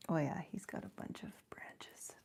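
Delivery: soft unvoiced whisper. Real, not acted. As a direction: murmur it as a hushed aside. Aside watching outside.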